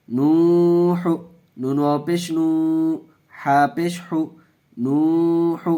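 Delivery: نوح with the long u held a full beat; نوح slowly, the long u held, the final h short; waw drawn out. Shown as a demonstration. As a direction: stretch the u in نوح. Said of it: "اس کو کھینچ کے پڑھا".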